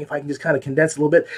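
quick, rushed delivery; talking fast. Real, not acted. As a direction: rattle it off quickly. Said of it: "You can see I'm talking faster".

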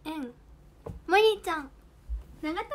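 normal talking voice

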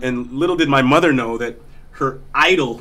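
ordinary talk

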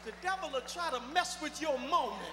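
loud preacher's exhortation; preaching at full force. Acted. Preacher warning the church about the devil, preaching hard. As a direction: drive it home to the witnesses.

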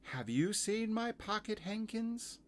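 put-on high little-girl character asking; Lucy. Girl asking about lost hankies, high-pitched. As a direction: ask in a high, childlike story voice. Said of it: "said Lucy".

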